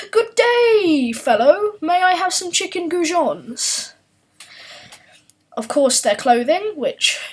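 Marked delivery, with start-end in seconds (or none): 0.1-3.9 much higher, louder posh English greeting; exaggerated posh British accent. Shown as a demonstration. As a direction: overdo the upper-class Brit, squeaky and proud. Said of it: "would be".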